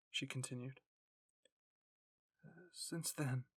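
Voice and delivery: lightly voiced, broken girl. A narrator's reading. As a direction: speak as if she has given up. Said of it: "She sounded so broken".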